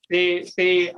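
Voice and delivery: ordinary talk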